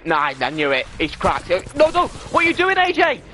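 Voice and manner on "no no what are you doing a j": high frantic cry, much higher pitch; panicked yelling. Real, not acted. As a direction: shriek at a teammate in panic. Panicked at teammate in fight.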